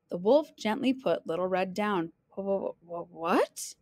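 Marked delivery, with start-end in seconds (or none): 2.3-3.7 low, soft stutter rising into a question; stunned stammer. Acted. Little Red set down by wolf, stunned stammer. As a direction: stumble on the first sound in disbelief.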